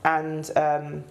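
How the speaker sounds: ordinary talk, nothing marked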